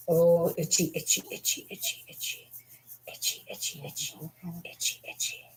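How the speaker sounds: hushed, voiceless scratching chant; playful whisper. Pretend. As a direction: whisper it in quick little repeats. Playful itchy chant while scratching dog.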